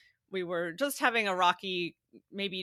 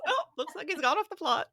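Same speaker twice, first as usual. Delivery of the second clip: put-on high, lilting Irish accent; Irish nurse impression; 9 semitones higher than usual. Mother repeating the Irish nurse's phrase to her son, Irish accent. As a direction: do the kindly Irish nurse, pitched up. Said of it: "I've used that with him ever since".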